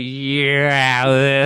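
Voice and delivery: long drawn-out Boston 'Yeah'; stretched Boston yeah. Retold or imitated. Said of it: "Stretches it".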